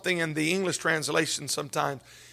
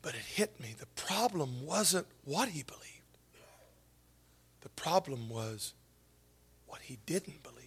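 hushed whisper; 2 semitones lower, 12 dB quieter than usual. Acted. Preacher whispering his insight to the congregation. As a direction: lean in and whisper the revelation. Sermon's hushed revelation repeated.

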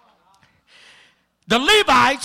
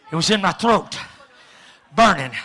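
usual speaking voice

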